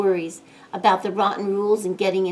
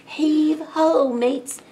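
high pirate rallying call; rally the crew with a cry; hearty storybook sailor's call; cried out; 8 semitones higher, 3 dB louder, much slower than usual. A narrator's reading. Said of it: "cried Jake".

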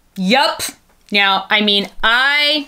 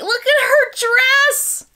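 much higher, slow and gushing; delighted squeal; 4 dB louder, much slower than usual. Real, not acted. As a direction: gush over the dress. Admiring doll dress.

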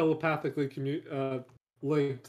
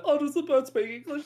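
higher, wide-eyed asking; mock naive question; 11 semitones higher than usual. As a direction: ask like a clueless tourist. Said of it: "just that".

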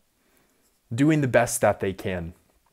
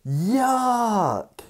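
disgusted yuck; 9 semitones higher than usual. Real, not acted. Showing photo of diseased toes.

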